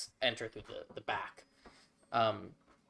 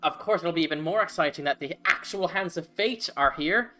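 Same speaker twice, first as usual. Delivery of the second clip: higher, louder, bubbly gnome voice; eager gnome cleric; 4 semitones higher, 4 dB louder than usual. Acted. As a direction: gush like a flustered fan meeting heroes. Gnome keeps talking.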